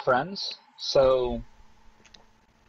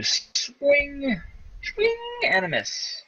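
sing-song commentary; 11 semitones higher, much slower than usual. A narrator's reading. Narrating goblin miss, sing-song.

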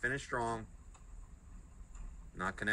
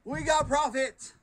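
shrieking jackpot winner; 12 semitones higher, 4 dB louder than usual. Real, not acted. Big win, excited shrieking.

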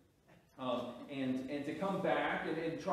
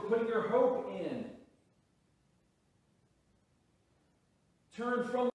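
loud, higher, slow pleading call; preaching at full force; 7 semitones higher, 6 dB louder, slower than usual. Acted. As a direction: drive home the call to turn. Preaching repentance.

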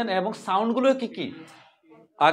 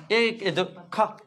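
usual speaking voice

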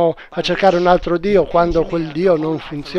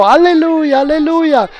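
higher, louder, fast rejoicing; joyful shout; 7 semitones higher, 6 dB louder than usual. Acted. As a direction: shout alleluia in triumph. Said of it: "E lei gridò".

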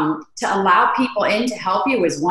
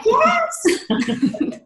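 excited yes; 13 semitones higher than usual. Retold or imitated. Retelling her own reaction.

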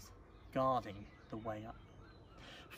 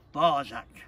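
a hint of stern father, loud; 11 dB louder than usual. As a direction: cut in firmly as the father, without raising pitch. Father cuts off the child.

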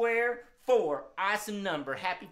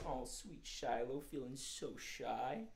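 tender; 6 semitones lower, 11 dB quieter, slower than usual. Acted. Comforting shy puppet squirrel.

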